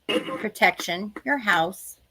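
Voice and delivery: calm, slow meditation guidance; guided meditation. Acted. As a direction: guide them gently into protection. Leads a protection meditation.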